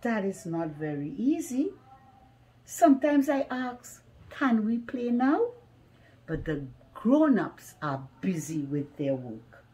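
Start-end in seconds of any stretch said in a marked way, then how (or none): none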